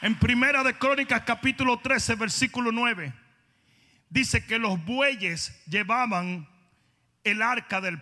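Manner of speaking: high, loud, driving preacher voice; fiery preaching. Acted. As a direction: preach it with full fire to the congregation. Announcing scripture at full force.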